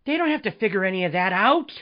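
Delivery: ordinary talk, nothing marked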